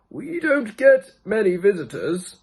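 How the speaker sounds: put-on a gentle, high old man welcoming a guest; first old man. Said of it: "said the first old man".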